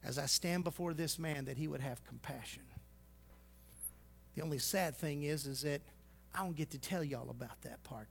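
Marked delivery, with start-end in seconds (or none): 0.0-2.6 soft, low, reverent plea; hushed petition before the king; humble prayer. Retold or imitated. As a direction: pray it quietly and humbly. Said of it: "He says".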